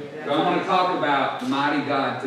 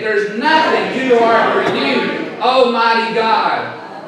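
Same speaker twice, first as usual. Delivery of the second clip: loud, slow, high conviction; saying it like you mean it; 6 semitones higher, 7 dB louder, slower than usual. Shown as a demonstration. Preacher urging church to mean it, emphatic declaration. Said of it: "Say it like you mean it".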